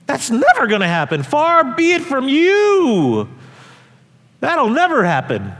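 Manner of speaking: high, slow, disbelieving outcry as Peter; Peter protesting. Retold or imitated. Peter objects to Jesus predicting his death, incredulous protest. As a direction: play Peter refusing to believe it. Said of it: "And Peter say".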